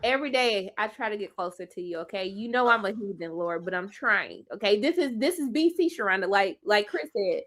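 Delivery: quiet, low mock prayer. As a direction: pray softly and a bit cheekily.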